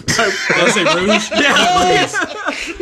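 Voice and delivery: high, helpless laughter through the words; laughing at own slip. Real, not acted. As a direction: crack up over your own flub.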